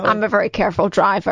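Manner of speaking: flat, even Rain Man voice; Rain Man impression. Co-host mimicking the host's voice as Rain Man. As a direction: quote it like Raymond from Rain Man. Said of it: "You were doing".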